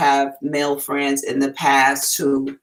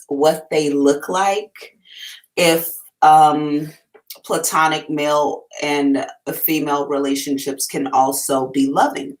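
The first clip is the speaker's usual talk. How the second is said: ordinary talk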